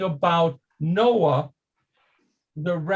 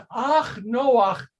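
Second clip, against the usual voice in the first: Yiddish accent; 3 semitones higher than usual. Shown as a demonstration. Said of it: "So when it says".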